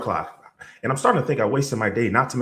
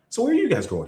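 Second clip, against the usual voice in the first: surprised question; 13 semitones higher, faster than usual. Retold or imitated.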